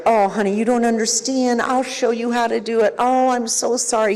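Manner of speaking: high, fussing rescuer voice; over-helpful rescuer. Acted. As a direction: rush in to soothe and rescue a struggling child. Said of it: "somebody who is gonna rescue every".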